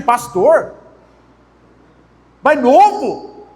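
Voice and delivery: high, drawn-out incredulous question; imitated surprise. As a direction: act out a woman taken aback. Woman reacts to young pastor.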